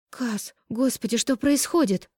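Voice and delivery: high, slow, breaking plea; horrified at the sight of him; frightened. A narrator's reading. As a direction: voice rising in panic. Sees her beloved unconscious.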